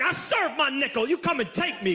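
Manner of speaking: high-pitched, defiant jailbird swagger; cocky ex-con confidence. Acted. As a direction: dare them like a hardened ex-con. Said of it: "With the confidence of guys who have ... already been to jail".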